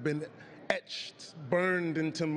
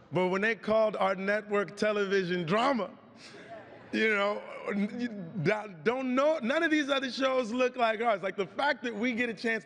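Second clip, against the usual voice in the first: excited; 6 semitones higher than usual. Real, not acted. Thrilled about the surprise win.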